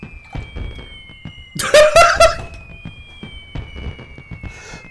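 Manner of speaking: long, very high cackling laugh; shrill delighted laughing fit; high cackle. Real, not acted. Victory animation plays.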